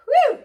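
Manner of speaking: loud whoop, pitch jumping up; excited whoop. Real, not acted. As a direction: cheer them on. Trainer cheering workout set done.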